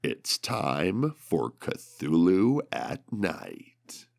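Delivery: usual speaking voice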